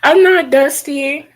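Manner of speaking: nervous and upset, defending yourself; scared. Real, not acted. Nervous caller answering the host's question, scared. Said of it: "I'm scared".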